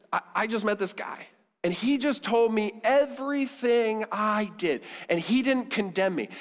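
high-pitched, eager retelling as the woman; acted excitement, high and emphatic; amazed testimony. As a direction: tell the neighbors your astonishing news, pitch lifted. Acting the woman telling her neighbors.

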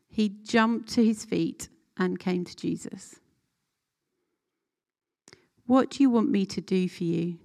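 clear, measured Bible reading to the group; scripture reading. Acted. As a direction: read the passage so they can picture the scene. Reads the scripture passage aloud.

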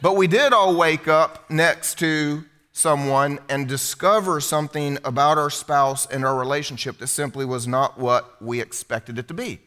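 usual speaking voice

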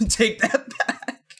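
voiceless, drawn out through laughter; slow quote squeezed out through a laugh; laughing mock quote. Fan retelling Twitch chat's reaction, laughing mock outcry. Quoting chat while laughing.

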